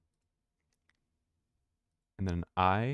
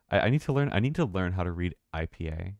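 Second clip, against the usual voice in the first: normal talking voice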